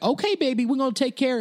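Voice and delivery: high, sing-out nurse voice; Atlanta nurse. Retold or imitated. Gold-scrubbed nurse to her boil patient, high and reassuring. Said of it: "That's very Atlanta".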